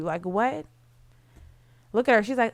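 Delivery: ordinary delivery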